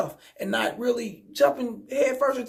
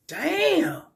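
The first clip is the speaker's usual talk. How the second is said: high, surprised "Damn" in a put-on voice; stunned damn; 10 semitones higher than usual. Retelling his reaction to her other dudes, high surprised damn. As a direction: react like the news just hit you. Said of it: "I'm like".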